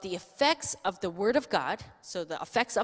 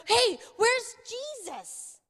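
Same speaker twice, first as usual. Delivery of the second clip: frantic call; 14 semitones higher, 4 dB louder, slower than usual. Acted. Disciples searching in the storm.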